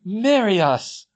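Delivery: loud, high, drawn-out cry of delight; excited exclaim. A narrator's reading. The twins exclaim to Hermione, loud and delighted. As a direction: throw your arms wide and shout it. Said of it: "threw their arms wide and exclaimed".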